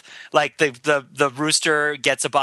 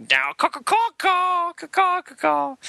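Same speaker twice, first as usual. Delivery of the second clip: high, drawn-out crow caws; crow cawing; 12 semitones higher, slower than usual. Pretend. Podcaster imitates crows having sex, high cawing. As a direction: caw it out like a squawking crow.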